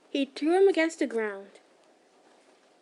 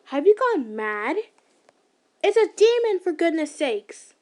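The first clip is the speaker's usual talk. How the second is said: angry outburst; 4 semitones higher, 7 dB louder than usual. Acted. The stranger arguing with the slayer over the demon, exasperated shout. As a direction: explode in disbelief.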